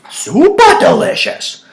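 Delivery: "Super delicious!" squealed high, loud and slow; over-the-top praise of the beer; hammed-up rave. Shown as a demonstration. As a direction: gush over the homebrew.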